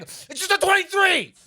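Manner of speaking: yelling at coworker. Retold or imitated.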